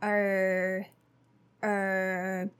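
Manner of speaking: er quick and low. Shown as a demonstration. Teacher showing the er sound. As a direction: say er, relaxed. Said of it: "O R is also".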